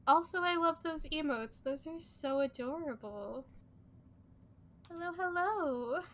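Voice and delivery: high, loud gushing; cute anime streamer. Real, not acted. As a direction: gush over the cute emotes. Gushing over emotes.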